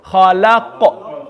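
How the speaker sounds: slow خلق with a bounced final qaf; echoing qaf. Shown as a demonstration. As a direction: draw out خلق and let the qaf echo. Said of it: "almost like an echoing sound".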